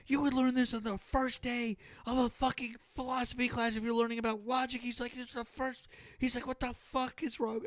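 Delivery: high-pitched mock exasperated rant; imitating a flip-out. Retelling how the streamer flipped out on a caller, high mock rant. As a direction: squeak out the rant like an outraged streamer. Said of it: "he was kinda flipping out on the guy".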